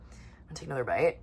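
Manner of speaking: quick whispered aside while eating; whispering with food in hand. Real, not acted. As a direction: drop to a fast hush mid-story. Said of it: "nourishing my body right now".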